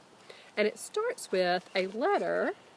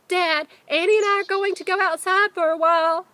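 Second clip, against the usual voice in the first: high, loud call to the kitchen; shouted; 11 semitones higher, 12 dB louder than usual. Acted. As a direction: yell it so dad hears in the next room. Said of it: "he shouted".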